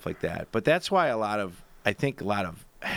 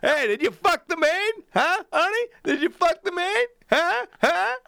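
high, loud mock badgering; hammed-up needling taunt; teasing Tom Arnold; 4 dB louder than usual. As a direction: poke and prod, grinning. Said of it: "he was like going".

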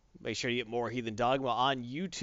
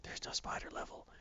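whispering; 15 dB quieter than usual. Real, not acted. Whispered aside.

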